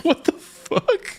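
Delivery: high, drawn-out mock shock; hammed-up slow squeal of disbelief; imitated disbelief. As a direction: stretch it out high through laughter. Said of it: "You're like".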